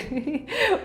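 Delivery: small real laugh; giggle. Real, not acted. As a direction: giggle at your own mistake. Amused by her own embarrassment.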